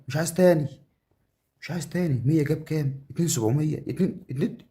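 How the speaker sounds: high-pitched, excited outburst; breathless-with-joy gamer reaction; thrilled. Real, not acted. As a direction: burst out, overwhelmed by a big win. Thrilled by a big game win.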